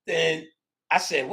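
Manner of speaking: normal talking voice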